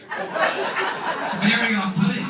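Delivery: milk the disgust slowly; loud drawn-out disgust. Acted. Punchline on the urinal.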